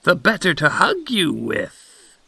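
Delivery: high put-on granny voice; reply as a wolf in granny's bed; wolf posing as grandmother.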